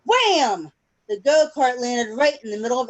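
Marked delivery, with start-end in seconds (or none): none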